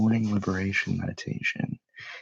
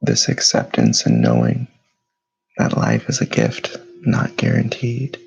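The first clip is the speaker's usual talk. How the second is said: guided meditation; 5 dB louder than usual. Acted. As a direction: lead them through stillness, unhurried and steady. Leads a morning meditation.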